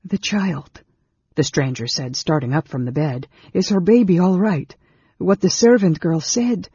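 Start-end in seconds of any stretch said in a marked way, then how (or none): 0.1-0.8 higher, alarmed; fearful. A narrator's reading. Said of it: "There was fear in his voice". 3.5-6.7 high, loud, urgent alarm; fearful. A narrator's reading. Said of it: "There was fear in his voice".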